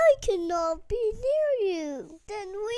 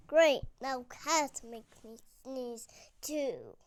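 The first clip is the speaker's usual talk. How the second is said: ordinary delivery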